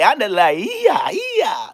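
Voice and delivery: loud hype shout; hyped shout at the haters; excited shout. Real, not acted. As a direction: yell it out, pumped up. Hyping himself up loudly.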